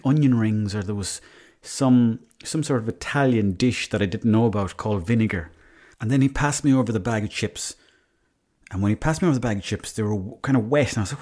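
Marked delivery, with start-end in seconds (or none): none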